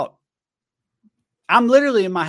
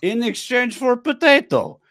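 high-pitched mock Russian; Russian accent; 9 semitones higher than usual. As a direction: tell it as a hammed-up Russian. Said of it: "Russian thrift shopping".

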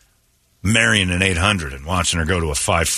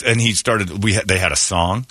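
ordinary talk, nothing marked

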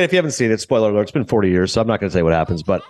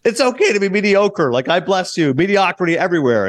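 higher-pitched, half-hearted calling out; mild mock yell; 7 semitones higher than usual. Old Salieri blessing people from his wheelchair, mild mock yell. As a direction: imitate someone calling out to a crowd, lightly. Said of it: "he's, like, yelling to people".

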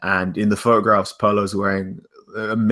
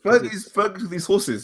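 a poor, high attempt at a Scottish accent; bad Scottish accent; 12 semitones higher than usual. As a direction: try a Scottish accent and fail at it. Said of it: "You just made some noises".

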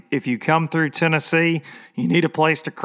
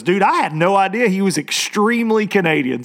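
imitated country buddy; 6 semitones higher than usual. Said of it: "He goes".

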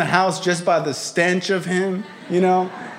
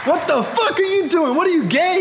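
dad waking up freaked out; shocked outburst; 12 semitones higher than usual. Retold or imitated. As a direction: yell it in panicked disbelief.

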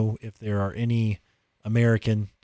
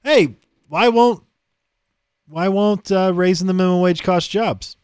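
high, loud mock questioner; 7 semitones higher, 5 dB louder than usual. Podcaster mimics people asking about minimum wage, mock questioning. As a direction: play someone asking in a raised voice.

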